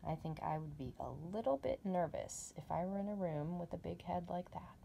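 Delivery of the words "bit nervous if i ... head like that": whispered nervous aside; hushed aside. Real, not acted. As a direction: drop to a whisper as if scared. Reader's nervous aside about head.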